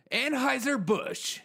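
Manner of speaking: Anheuser-Busch called out high, loud and drawn out slowly; exaggerated brand name. Host mocking the beer deal, high slow exaggerated reveal.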